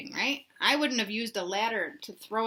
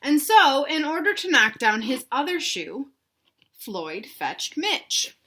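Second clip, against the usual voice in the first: louder start, then slowing right down on the name; reading to children; 5 semitones higher, 5 dB louder than usual. A narrator's reading. Teacher reading to children, slow suspenseful storybook voice. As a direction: build it, then slow to a reveal. Teacher reads picture book aloud.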